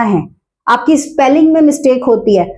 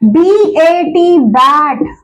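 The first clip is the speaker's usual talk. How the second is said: बी ए टी spelled slowly, letter by letter; teacher spelling बी ए टी slowly for a child; much slower than usual. Shown as a demonstration. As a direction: sound out बी ए टी one letter at a time. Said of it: "लिखना सिखा रही हूं".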